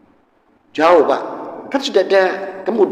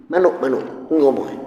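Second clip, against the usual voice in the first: ordinary talk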